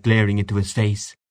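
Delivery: usual speaking voice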